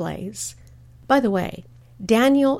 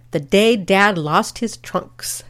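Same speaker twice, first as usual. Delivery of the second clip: slow, measured caption read; 4 dB louder, slower than usual. Retold or imitated. Daughter reading mom's caption in the family album, measured title read. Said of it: "my mother's handwritten caption".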